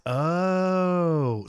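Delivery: long drawn-out dawning "oh"; retold realization. Pilot recalling flying the Slick, drawn-out realization. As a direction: let the realization sink in on one stretched sound.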